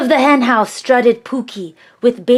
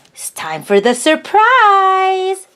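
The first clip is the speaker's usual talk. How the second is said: excited reveal; 6 semitones higher than usual. Acted. Host turns to surprise reveal.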